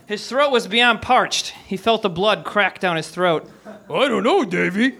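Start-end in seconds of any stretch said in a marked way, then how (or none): 3.7-4.9 put-on dog character voice; goofy talking-dog voice; talking cartoon dog. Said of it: "Is that what that dog sounded like?".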